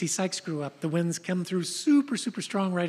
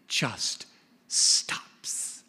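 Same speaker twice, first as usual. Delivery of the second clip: whispered; 8 semitones lower than usual. A narrator's reading. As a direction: breathe the word out. Storm stops.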